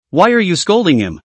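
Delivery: ordinary delivery, nothing marked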